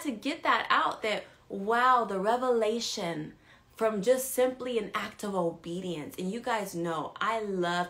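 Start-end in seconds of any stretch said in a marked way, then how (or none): none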